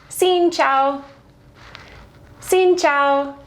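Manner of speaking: Xin chào said slowly and clearly as a model; Vietnamese greeting demo. Shown as a demonstration. Teaching travellers to greet locals, careful pronunciation. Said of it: "what's helpful is knowing".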